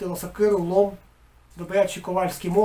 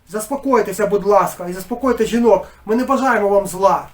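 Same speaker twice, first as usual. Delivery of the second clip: loud pleading; 2 semitones higher, 8 dB louder than usual. A narrator's reading. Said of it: "додав уже до батька".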